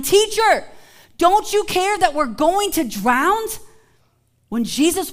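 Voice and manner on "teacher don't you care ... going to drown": high, loud cry for help; frightened disciples shouting; panicked shout. Retold or imitated. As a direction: call out in fear of drowning. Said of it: "woke him up shouting".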